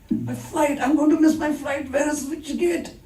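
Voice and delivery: high-pitched, frantic acted panic; desperate traveler. Desperate passenger about to miss a flight, high acted panic. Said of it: "if you are desperate".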